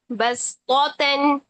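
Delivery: बसतौतन slow with clear s; बसतौतन, seen not saad; Qur'anic recitation. Shown as a demonstration. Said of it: "इन दो words को हम सीन के साथ पढ़ेंगे".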